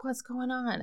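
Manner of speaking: a hint of a startled potato; talking vegetable. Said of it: "and the potato's like".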